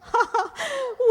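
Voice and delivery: put-on bright giggle at the baby; high giggle. Laughing at the baby.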